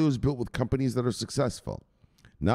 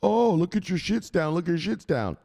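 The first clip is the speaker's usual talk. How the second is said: higher taunting mock voice; mocking hater; 9 semitones higher than usual. Mimicking people who mock falling Bitcoin, higher taunting voice. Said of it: "all these people come around".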